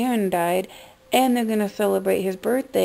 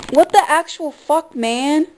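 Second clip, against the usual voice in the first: high, loud stunned disbelief; shocked outburst; 6 semitones higher, 4 dB louder than usual. Real, not acted. Vlogger reacting to a star's death, in shocked disbelief. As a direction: blurt it out in real shock.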